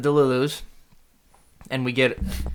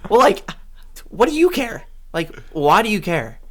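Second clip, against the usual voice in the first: indignant, pitch jumping much higher; fuming retort; 7 semitones higher than usual. Retold or imitated. Retorting to the cashier who questions who pays, indignant and high. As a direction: snap back, annoyed. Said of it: "So I'm fuming at this point".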